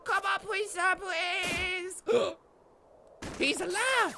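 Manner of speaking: high, anguished shout; desperate pleading shout. Pretend. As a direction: plead with a dying soldier. Pleading for dying general.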